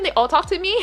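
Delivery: usual speaking voice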